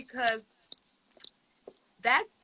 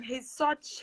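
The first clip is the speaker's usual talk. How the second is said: normal talking voice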